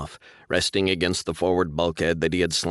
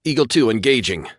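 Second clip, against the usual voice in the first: radio callout; 6 semitones higher, 6 dB louder than usual. A narrator's reading.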